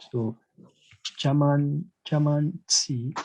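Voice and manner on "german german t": ordinary talk, nothing marked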